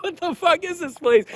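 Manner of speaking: wife arriving in a rough town, aghast; wife's shocked voice. Retold or imitated. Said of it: "she's like".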